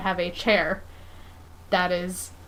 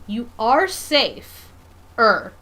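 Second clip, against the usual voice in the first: safe-er stretched very slowly and a bit louder; drawn-out safer; 2 semitones higher, 6 dB louder, much slower than usual. Real, not acted. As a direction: drag out safe-er to hedge the claim. Stretching the word to qualify it.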